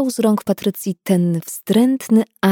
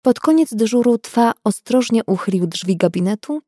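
usual speaking voice

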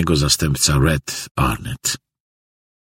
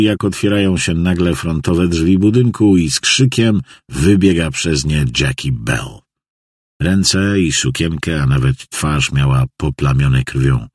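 ordinary delivery, nothing marked

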